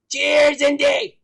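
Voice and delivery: loud, drawn-out cheer; shouted toast. Real, not acted. Toasting a fan of the show, loud hearty shout. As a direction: raise a glass and holler it.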